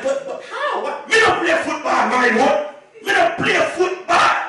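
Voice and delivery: high, loud, startled objection; can't believe what he's hearing; startled son-in-law protesting loudly; baffled protest. Retold or imitated. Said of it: "I'm like".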